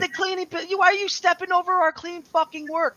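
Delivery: high, strained exasperated yell; retold yelling. Husband re-enacting scolding his wife for stepping on clean work, high yell. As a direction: re-enact berating someone, pitch way up. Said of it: "I'm yelling at her like that".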